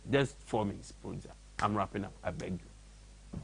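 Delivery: low, very soft plea to the producer; quiet off-hand begging, dying away; hushed pleading aside. Real, not acted. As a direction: beg under your breath, fading to a near-whisper.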